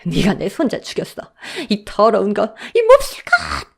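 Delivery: slap her with the words, wailing; raging wail. Acted. Grieving grandmother rages at the daughter-in-law, crying shriek. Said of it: "사정없이 따귀를 때렸습니다".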